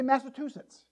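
MASSACHUSETTS as Massa-TOO-setts, T for CH; Texan mispronunciation. Retold or imitated. Preacher imitating Texans failing the word, Massa-TOO-setts for MASSACHUSETTS. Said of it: "That's the way they say it".

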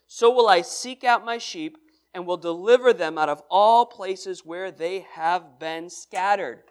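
slow, weighty Bible reading with loud opening; scripture reading. Acted. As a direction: read the verses like a proclamation. Reads scripture aloud.

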